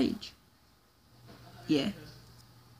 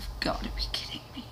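whispered disbelief; frustrated whisper; 13 dB quieter, faster than usual. Real, not acted. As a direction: hiss it out in disbelief. Frustrated after crash.

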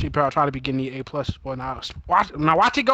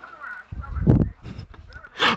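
high breathy laugh at the screen; burst of laughter; 10 semitones higher than usual. Real, not acted. Laughing at the game on the TV. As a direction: crack up at the game.

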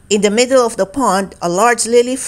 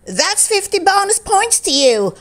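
high, excited umpire; 10 semitones higher than usual. A narrator's reading. Umpire awarding Barry bonus points, excited. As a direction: cheer the points out. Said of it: "said Keith excitedly".

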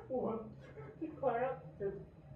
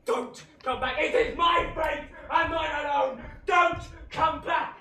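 loud, high shout, last words slow; commanding shout; 6 semitones higher, 8 dB louder than usual. Acted. Faustus to friends, shouting at them not to return. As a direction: order them away with all your force.

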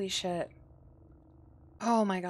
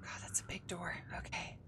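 awed, soft whisper; real hushed whisper; 3 semitones lower, 9 dB quieter than usual. Real, not acted. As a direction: whisper in dread at the door. Creeping through scary game.

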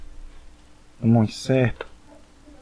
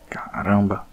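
whispered; 6 semitones lower, 4 dB quieter, slower than usual. Real, not acted. Tense moment in fight.

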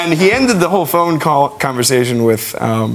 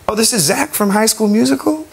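imitating [name]; 9 semitones higher than usual. [name] greeting a young fan, high light impression. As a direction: lift the voice up high and gentle. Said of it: "And he goes".

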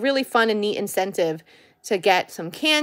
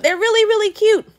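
gushing; 10 semitones higher, 6 dB louder than usual. Real, not acted. Gushing over the cute toys.

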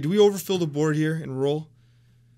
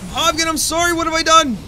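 much higher, louder, dismayed apology; anguished cry; 10 semitones higher, 6 dB louder than usual. Real, not acted. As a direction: wail it like you just ruined everything. Streamer panics over lost unit.

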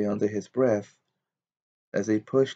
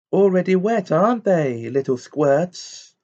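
high, loud jeer, slowing at the end; gleeful mischief-maker's voice; taunting poltergeist; 8 semitones higher, 5 dB louder than usual. A narrator's reading. Poltergeist pelting pupils with water bombs, high taunting jeer.

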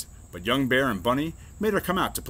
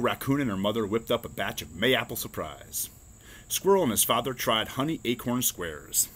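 ordinary delivery, nothing marked

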